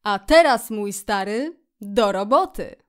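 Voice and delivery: loud, hearty call to start. A narrator's reading. Rat summons Mole to the meal, calling out. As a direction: punch the opening, rally a friend. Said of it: "szczur zawołał".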